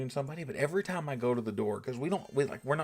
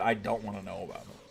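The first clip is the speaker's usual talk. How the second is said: ordinary talk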